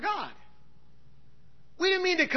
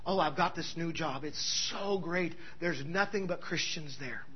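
lower, softer smug churchy voice; mocking churchgoer; 8 semitones lower, 9 dB quieter than usual. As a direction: parody the self-satisfied believer. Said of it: "Listen to this".

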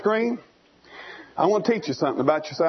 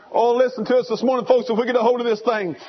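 fervent preaching, rising higher; preaching at full force; 5 semitones higher than usual. Acted. Preacher painting the lost eagle for the congregation, fervent preaching. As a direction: build the preaching up.

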